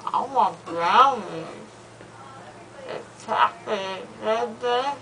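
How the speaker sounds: loopy slurred rambling; drugged, drowsy talk; after wisdom teeth. Real, not acted.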